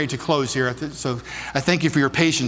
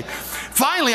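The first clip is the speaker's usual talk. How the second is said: ordinary talk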